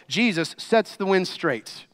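ordinary delivery

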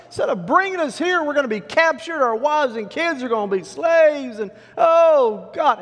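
high, loud hammed-up complaint; moaning and wailing. Israelites asking God why he didn't kill them, wailing. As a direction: pour out a mock lament to heaven. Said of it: "moaning and wailing".

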